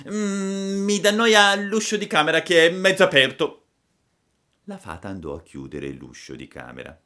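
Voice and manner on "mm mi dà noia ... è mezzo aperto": put-on high, loud childish complaint; whining puppet. A narrator's reading. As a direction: grumble out an excuse in a puppet squeal. Puppet invents another excuse. (burattino lagnoso, voce acuta)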